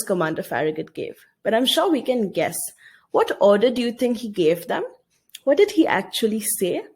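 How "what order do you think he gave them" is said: normal talking voice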